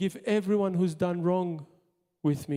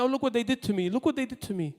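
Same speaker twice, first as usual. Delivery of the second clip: offended whining; 5 semitones higher, much faster than usual. Retold or imitated. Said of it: "because they're offended".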